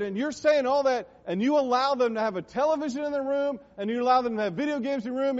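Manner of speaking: loud, high-pitched preaching; dad's preaching. Retold or imitated. Retelling dad's warning to parents about television, loud preaching. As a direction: imitate dad hammering at the parents. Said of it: "That's how he said it".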